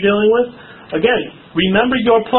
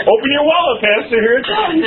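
laughing tease; 7 semitones higher than usual. Acted. Joking about the buck stopping.

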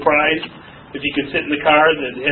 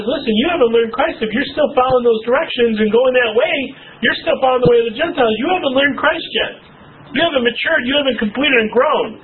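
raised, higher pitched preaching push; building sermon emphasis; rising preaching; 6 semitones higher than usual. Acted. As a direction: press the point home to the congregation. Preacher pressing the congregation.

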